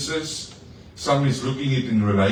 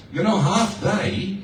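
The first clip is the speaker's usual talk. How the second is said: drawn-out, much higher mock weariness; mock exhausted; 8 semitones higher, slower than usual. Pastor telling the church how tired he got. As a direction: stretch it out like you're worn out. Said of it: "I was exhausted".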